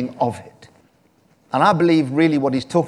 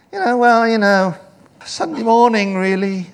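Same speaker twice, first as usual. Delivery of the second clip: high, loud whiny mimicry of a reluctant churchgoer; put-on high voice making excuses; mock excuse-making; 9 semitones higher, 6 dB louder than usual. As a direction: pitch it up high and hedge.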